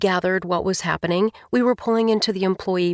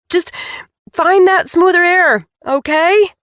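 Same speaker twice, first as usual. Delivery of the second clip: shouting; 10 semitones higher, 7 dB louder than usual. A narrator's reading. Scared wife calling over engine.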